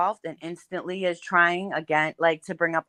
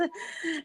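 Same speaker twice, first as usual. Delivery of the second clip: quick high nervous giggle; a little uneasy laugh; 10 semitones higher, 11 dB quieter than usual. Real, not acted. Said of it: "I do that little nervous laugh".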